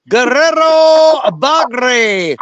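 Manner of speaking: slow, deliberate "Guerrero Bagre" in Spanish, trilled r's, hard g; proper Spanish pronunciation. Shown as a demonstration. Host showing chat how to say it properly, slow and deliberate. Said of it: "That's how you say it properly".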